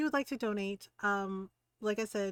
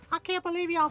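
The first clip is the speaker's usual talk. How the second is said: put-on loud, higher Southern teen outrage; mock an indignant dragon kid with a Southern twang; exasperated impression of a Southern-accented kid; 9 semitones higher, 7 dB louder than usual. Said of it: "her parents don't have a southern accent".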